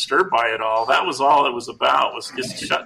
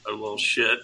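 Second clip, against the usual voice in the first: ordinary talk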